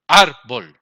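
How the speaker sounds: "Árbol" with a loud, punched "Ár"; stress on first syllable. Shown as a demonstration. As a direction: hit the "Ár" of "Árbol", drop the "bol". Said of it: "Dónde estamos haciendo la mayor fuerza".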